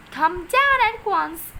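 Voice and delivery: high, loud and slow delighted cry; excited cry. A narrator's reading. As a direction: call out gladly. Mrs Silver calling upstairs eagerly.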